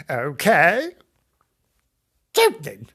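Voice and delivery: exaggerated old lady huff; old woman voice. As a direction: toss it back loudly as an old woman. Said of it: "That was the old woman".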